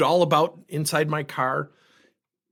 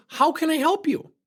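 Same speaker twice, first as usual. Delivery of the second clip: bright clerk greeting; 13 semitones higher than usual. Retold or imitated. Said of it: "gets behind the bakery and goes".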